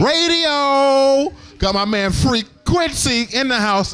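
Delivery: big, slow, singing shout-out to the DJ; hype shout-out. Acted. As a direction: shout it out like a radio hype man.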